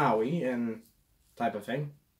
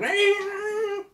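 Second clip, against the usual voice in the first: monster laugh. Retold or imitated. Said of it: "the big rat monster is like".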